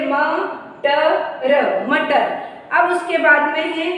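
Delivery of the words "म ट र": मटर said letter by letter, slowly; sounded out. Shown as a demonstration. Teacher showing how मटर is read, sounded out letter by letter. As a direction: draw out म, ट, र as separate sounds. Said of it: "तो इसको कैसे पढ़ेंगे?".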